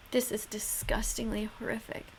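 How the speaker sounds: horrified hushed whisper. Real, not acted. Reacting to cruel footage, horrified whisper. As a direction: breathe it out in shock. Reacting to a disturbing animal video.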